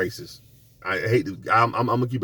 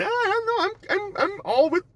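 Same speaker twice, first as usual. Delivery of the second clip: very high airy fake-friendly voice. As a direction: gush insincerely to seem cool. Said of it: "smile in your face".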